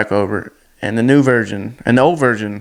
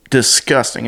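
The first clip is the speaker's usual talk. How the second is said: normal talking voice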